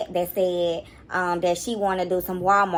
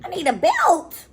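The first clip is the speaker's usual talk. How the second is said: mock threat; 7 semitones higher, 4 dB louder, slower than usual. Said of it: "making me be like".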